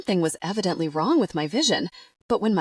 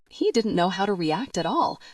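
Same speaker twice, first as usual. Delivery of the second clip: plain reading voice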